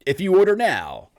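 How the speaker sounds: loud, high infomercial pitch; salesman voice. Acted. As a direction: sell it like an early nineties ad. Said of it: "you got a pretty good, like, salesman voice for the early nineties".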